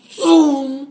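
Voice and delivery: high, loud whooshing zoom; train zoom noise. Retold or imitated. Imitating the bullet train speeding past with a loud zoom. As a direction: zip the word past like a fast train. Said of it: "No, it's more like".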